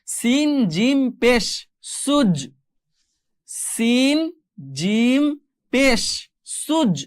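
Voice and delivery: slow, drawn-out "সিন জিম পেশ", then "সুজ"; name each letter long and apart, then give "সুজ"; spelling drill. Shown as a demonstration. Teacher reads out the exercise, slow letter-by-letter drill to "সুজ". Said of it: "আমরা বাকিগুলো একটু পড়ার চেষ্টা করি".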